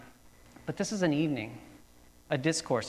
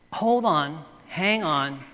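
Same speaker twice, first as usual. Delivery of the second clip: normal talking voice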